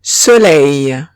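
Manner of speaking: soleil ending in eil, so-LAY; French soleil. Shown as a demonstration.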